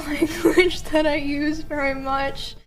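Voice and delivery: ordinary talk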